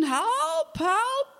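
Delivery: high, panicked cry of help; a child screaming for help from a boat; calling for help. Retold or imitated. Little girl adrift on a lake calls for help, high panicked cry. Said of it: "ik begon te roepen".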